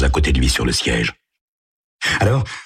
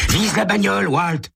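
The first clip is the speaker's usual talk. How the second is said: put-on cocky teen. Tommy points out the car.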